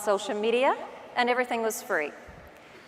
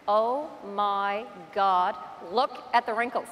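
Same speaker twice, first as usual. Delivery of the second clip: slow, drawn-out horror at herself; mock-appalled, stretching each word; acted dismay; 2 semitones lower, 3 dB quieter, slower than usual. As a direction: react aghast to your own video. Reacting in dismay to her video.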